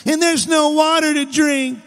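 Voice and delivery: high, whiny litany of complaint; whining. Acted. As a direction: whine on and on in protest. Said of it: "Can you hear 'em whining?".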